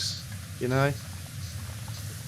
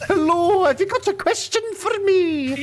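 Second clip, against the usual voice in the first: put-on much higher, louder Scottish accent; 5 dB louder than usual. Host imitates McCoy's mic walk in a Scottish accent. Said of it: "I'm doing a Scottish accent".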